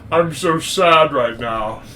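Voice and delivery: slow, drawn-out mock sadness; Connery. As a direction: play a drunken, self-pitying Connery. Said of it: "Connery is struggling with his sexuality".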